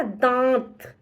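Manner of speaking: दांत with a clearly nasal आ; nasal demo word. Shown as a demonstration. Teacher demonstrates nasal दांत to the class. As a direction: let दांत ring through the nose and throat. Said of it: "नाक और गले दोनों से आवाज आ रही है".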